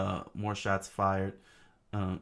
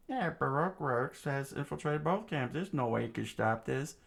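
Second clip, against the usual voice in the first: gleeful; 6 semitones higher than usual. Acted. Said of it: "smiled gleefully".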